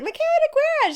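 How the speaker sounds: high, bright insistence; emphatic full name. Retold or imitated. Insisting on a friend to moderate the book signing, high and emphatic. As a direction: announce the name with total certainty. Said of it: "And I was like".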